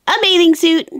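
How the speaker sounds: usual speaking voice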